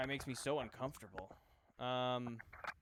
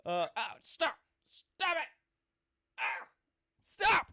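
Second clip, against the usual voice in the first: much higher, loud cries of pain; pained yelling; 12 semitones higher, 11 dB louder, slower than usual. Acted. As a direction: yelp at each slap. Being slapped awake.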